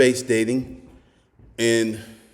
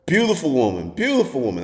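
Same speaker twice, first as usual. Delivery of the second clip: ordinary talk, nothing marked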